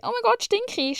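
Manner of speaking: sudden high squeal of alarm; mock panic. Imagining she smells, mock panicked squeal. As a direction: shriek it up high in mock horror. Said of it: "und so bin".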